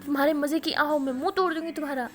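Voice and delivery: loud jealous threat; angry threat. Retold or imitated. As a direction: snap out the threat, hot-tempered. Jealous friend threatens in quarrel.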